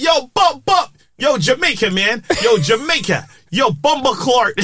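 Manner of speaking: high-pitched Jamaican crowd cheer; mock Jamaican hype. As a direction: hype up the room as excited Jamaicans. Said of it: "they were Jamaican".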